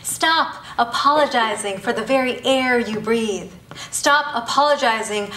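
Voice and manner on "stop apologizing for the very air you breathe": normal talking voice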